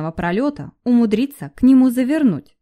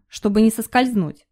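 ordinary delivery, nothing marked

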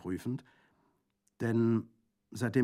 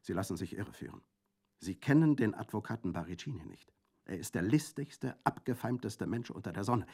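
firm voice; 5 semitones higher, 6 dB louder than usual. A narrator's reading. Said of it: "mit fester Stimme".

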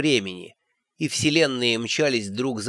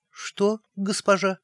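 put-on high, startled young girl; timid girl voice; 6 semitones higher, 4 dB quieter, slower than usual. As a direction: squeak it out, scared. Frightened girl asks back.

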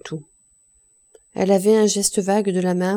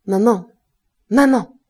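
violent call; 2 semitones higher, 8 dB louder than usual. A narrator's reading. Son shouts for his mother a second time, violently. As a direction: call her again, harder and angrier. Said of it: "plus violemment".

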